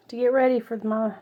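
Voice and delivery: normal talking voice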